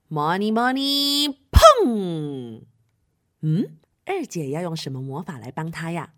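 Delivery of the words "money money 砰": slow rising chant, then loud bang; drawn-out incantation; magic spell. Acted. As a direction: cast it slowly and burst on the last word. Second sister casts spell.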